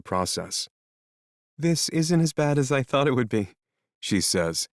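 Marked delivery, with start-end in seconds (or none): 1.6-3.5 put-on higher, lighter young woman; sniffly young woman character. Melody's voice.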